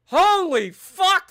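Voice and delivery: very high, loud, drawn-out gasp; stunned exclamation. Real, not acted. Streamer seeing a million dead, stunned loud exclamation. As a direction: blown away, stretch it out. Staggering battle casualty numbers.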